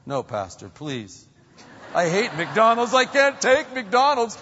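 high, loud mock whine; a parent begging the pastor, high mock whine; whining parent. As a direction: whine it out in protest.

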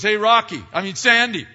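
high, loud teasing call; playful, high and loud; Jesus teasing. Retold or imitated. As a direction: rib him gently by his nickname. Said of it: "And Jesus goes".

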